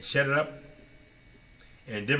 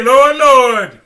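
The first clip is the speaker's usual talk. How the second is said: much louder, higher desperate plea; loud pleading; 12 semitones higher, 18 dB louder than usual. Retold or imitated. The many pleading at the judgment, loud. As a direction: cry out begging.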